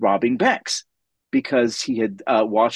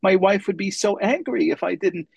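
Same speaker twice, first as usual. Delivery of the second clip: high, meek little voice, playing shy; timid, coy voice; 8 semitones higher than usual. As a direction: ask sheepishly, feigning innocence. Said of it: "very kind of timidly, in a very coy voice".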